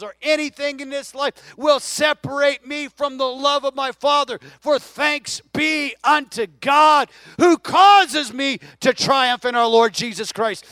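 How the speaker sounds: preacher voicing Paul's cry of deliverance; preaching Paul's cry. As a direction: build to a shouted triumph. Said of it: "Part of us is crying".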